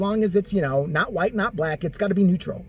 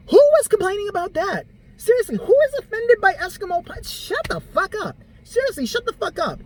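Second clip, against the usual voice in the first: exasperated; 13 semitones higher than usual. Real, not acted. Exasperated rant at offended people.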